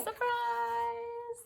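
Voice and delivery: high squeaky mock surprise; high surprise call. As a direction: echo it up high, lightly. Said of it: "the tree yelled".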